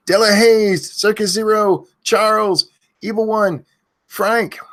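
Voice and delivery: high, loud shouted names for chat; screaming names. Real, not acted. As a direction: bellow each viewer name high. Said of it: "why in the hell is he screaming names".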